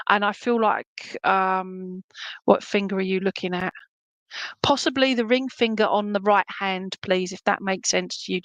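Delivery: usual speaking voice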